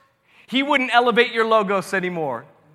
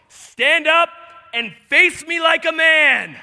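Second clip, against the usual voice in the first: booming God voice; 4 semitones higher, slower than usual. Retold or imitated. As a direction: challenge Job like a thunderous God. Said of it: "he goes up to me and says".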